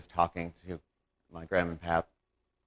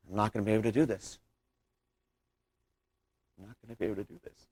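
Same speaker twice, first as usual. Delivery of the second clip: hurried, fading to very soft; quiet despair; 6 dB quieter, much faster than usual. Acted. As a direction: murmur it to yourself, giving up. Acted despair the night before amputation.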